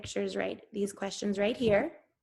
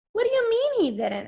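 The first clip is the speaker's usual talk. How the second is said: high, louder, startled disbelief; alarmed question; 12 semitones higher, 4 dB louder than usual. A narrator's reading. Benjamin asking Charlie in sudden alarm, startled high. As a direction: shoot the question up in shock.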